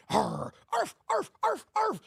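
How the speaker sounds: yappy dog barks, pitch jumping up; mock barking. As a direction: bark like a yapping little dog. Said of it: "you hear that".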